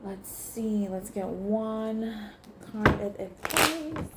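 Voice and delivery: drawn-out hushed ask; gentle murmur to the cards; soft slow request. Real, not acted. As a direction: stretch the request softly.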